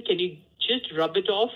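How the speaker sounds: ordinary talk, nothing marked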